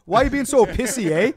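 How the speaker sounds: loud, high mock Canadian accent; playful imitation of a Canadian reporter, loud and high. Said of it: "their accents were so apparent to me".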